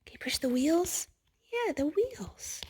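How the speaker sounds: hushed storybook whisper. Real, not acted. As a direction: lean in and whisper. Whispering to child over book.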